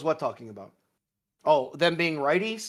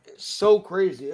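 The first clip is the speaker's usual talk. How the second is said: ordinary talk, nothing marked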